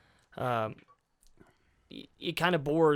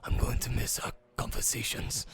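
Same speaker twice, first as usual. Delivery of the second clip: hushed, voiceless imitation of a film line; whispered movie quote. Fan quoting a film farewell, imitated whisper.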